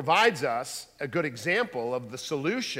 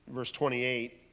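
ordinary talk, nothing marked

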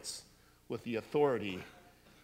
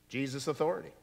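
normal talking voice

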